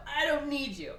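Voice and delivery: mock-defiant, insisting loudly; defiant refusal. Acted quarrel, man rebuffs his teacher.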